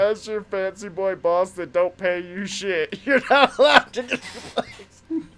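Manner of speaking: loud laughing boss rant; mock fancy boss. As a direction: boss scolding his employee, laughing.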